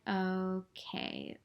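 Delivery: quiet, drawn-out, resigned reply; subdued, let-down acknowledgement; deflated acceptance. Retold or imitated. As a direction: let it sink, soft and slow. Retold deflated reply to his hedging.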